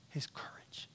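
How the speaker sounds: "His courage" breathed out in a soft whisper; awed whisper of "His courage". Shown as a demonstration. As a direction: hush it, almost no voice. Repeated phrase, first whispered.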